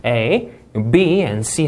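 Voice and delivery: A, B and C as a list, falling at C; list intonation. Shown as a demonstration. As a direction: lift A and B, drop on C. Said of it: "나열식이 ... 내려있는 그런 구조".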